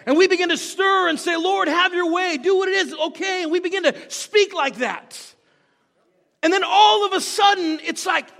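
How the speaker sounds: loud, high, exclamatory pulpit delivery; fervent preaching. Real, not acted. Preacher telling the church about praying in tongues, fervently. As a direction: preach with surging excitement.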